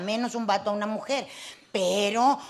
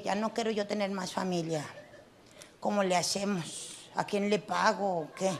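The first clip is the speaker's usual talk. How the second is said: sheepish quiet question; 5 semitones lower, 8 dB quieter than usual. Retold or imitated. Her own timid question to doctor.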